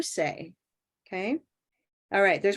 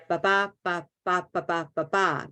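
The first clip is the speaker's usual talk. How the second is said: steady "ba, ba, ba" beats; even rhythm syllables; 3 semitones lower than usual. Shown as a demonstration. Teacher showing rhythm with even syllables. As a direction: tap out each syllable evenly. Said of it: "That's easy, right?".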